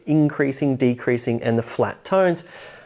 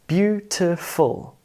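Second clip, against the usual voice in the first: BEAUTIFUL slowly split into three beats; syllable by syllable; 3 semitones higher, slower than usual. Shown as a demonstration. Teacher showing three mouth movements, BEAUTIFUL said slowly syllable by syllable. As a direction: break BEAUTIFUL into beau, ti, ful with gaps. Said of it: "It has three syllables".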